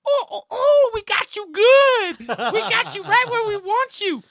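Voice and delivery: loud, high, drawn-out monkey hoots. Acted. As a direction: hoot and taunt like a flailing ape. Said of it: "flails his arms in a monkey-like fashion and goes".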